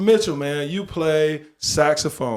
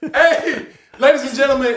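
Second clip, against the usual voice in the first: loud, high laughing showman intro; laughing as he brings on the show; emcee announcement; 9 semitones higher, 7 dB louder than usual. Acted. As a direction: call it out like a stage host. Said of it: "announcing like an emcee".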